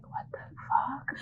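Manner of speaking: hushed, breathy whisper, a bit slow; whispered aside. Acted. Bystander whispering at the strolling bear, hushed. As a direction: mutter it under your breath in shock. Passersby react to bear walking.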